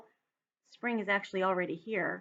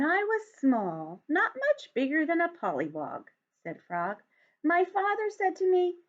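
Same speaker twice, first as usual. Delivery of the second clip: put-on lively read-aloud for young children; story narration; 3 semitones higher than usual. A narrator's reading. Teacher reads picture book aloud.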